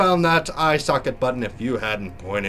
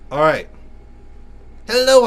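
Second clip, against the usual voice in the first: ordinary talk, nothing marked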